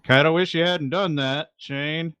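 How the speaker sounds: high, projected voice of a man below; Howard. Acted. Howard to Shane from down below, high calling voice. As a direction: call up regretfully from cover.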